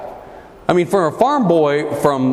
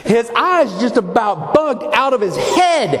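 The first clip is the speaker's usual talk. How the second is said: usual speaking voice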